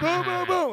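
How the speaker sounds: high-pitched mock crashing noises; rapid comic thuds, voiced way up high; tumbling thuds. Storyteller imitating John kicking something and going down, high comic thuds.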